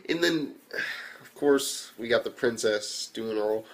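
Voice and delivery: usual speaking voice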